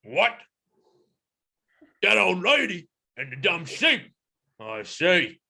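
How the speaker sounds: indignant creature voice; angry gorilla. Acted. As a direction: answer as a mildly angry gorilla. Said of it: "they're quite angry".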